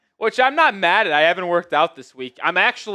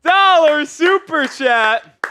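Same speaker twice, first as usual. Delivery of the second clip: slow, high, loud hype shout; excited shout; 11 semitones higher, 7 dB louder, slower than usual. Real, not acted. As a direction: call it out like a big win. Excited announcing big super chat.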